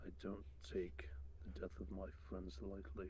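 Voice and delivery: soft unvoiced grieving threat; grim whisper. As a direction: hush it out in cold grief. Thrud answers grief-stricken.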